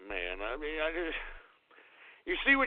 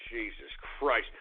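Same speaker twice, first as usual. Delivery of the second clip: ordinary delivery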